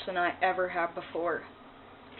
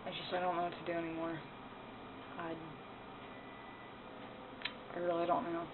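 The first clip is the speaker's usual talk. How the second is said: defeated; 11 dB quieter, faster than usual. Real, not acted. Despair after the rant.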